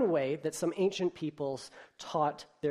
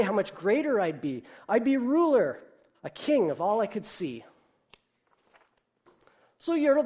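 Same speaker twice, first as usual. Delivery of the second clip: bouncy, higher and louder read-aloud; lively storybook reading; 4 semitones higher, 5 dB louder than usual. A narrator's reading. Preacher reading Dr. Seuss to the congregation, bouncy and animated. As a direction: read it like a bedtime story for kids. Reads Yertle the Turtle aloud.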